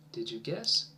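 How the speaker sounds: usual speaking voice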